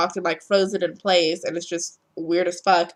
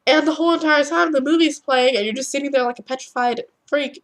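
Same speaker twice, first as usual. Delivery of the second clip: ordinary talk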